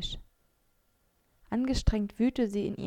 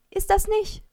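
much higher, loud alarmed cry; shout; 12 semitones higher, 9 dB louder than usual. A narrator's reading. Maike cries out to stop Mara eating the bread, alarmed shout. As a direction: yell out to stop her. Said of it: "rief ich".

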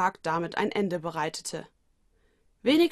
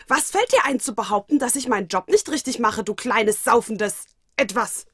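loud, raised, offended demand; loud, pitched-up scolding at a cheeky pet; indignant outburst; 3 semitones higher, 6 dB louder than usual. Acted. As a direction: tell the little creature off loudly. Watanuki furious at Mokona.

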